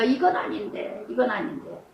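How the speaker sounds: low muttered "이건 아닌데"; muttered to herself. Retold or imitated. Preacher voicing her own regret quietly. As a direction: mutter it under your breath.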